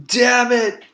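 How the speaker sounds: high, strained angry outburst; mock Hulk rage. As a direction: act out a hammed-up Hulk tantrum. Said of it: "so angry, like".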